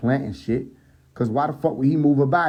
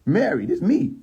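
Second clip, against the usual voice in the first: higher-pitched, rising plea to be recognized; mock incredulous; 7 semitones higher than usual. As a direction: act mildly amazed she doesn't know you. Jesus amazed she doesn't recognize him.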